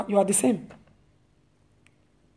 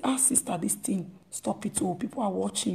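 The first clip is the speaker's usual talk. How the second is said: soft, much quieter private word; quiet correction; 4 semitones lower, 12 dB quieter than usual. Acted. Believer quietly corrects a sister before others, softly. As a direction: say it gently under your breath. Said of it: "you should go silently and say".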